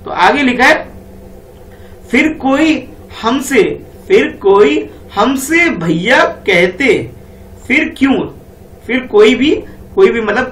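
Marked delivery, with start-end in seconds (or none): 2.1-8.3 slow sing-song reading of the poem line; slow, lilting poem recital; poem recitation. Shown as a demonstration. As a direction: recite it slowly for the kids. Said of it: "आगे पढ़ो बेटा क्या लिखा है".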